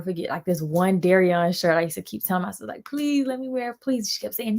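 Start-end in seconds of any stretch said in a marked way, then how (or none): 2.8-4.1 higher-pitched mock begging; acted-out beg, pitched up; retold pleading. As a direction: plead like a pestering little sibling. Retells begging sister for shirt.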